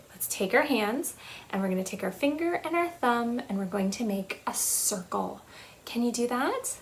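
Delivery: ordinary talk, nothing marked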